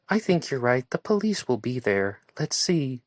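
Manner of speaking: lightly voiced Joanna. A narrator's reading.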